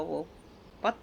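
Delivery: normal talking voice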